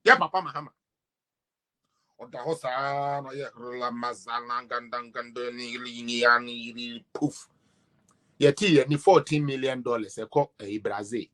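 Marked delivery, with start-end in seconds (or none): 2.2-7.5 low mock wizard muttering a spell; mock magic spell. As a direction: chant it like a hammed-up wizard casting a spell.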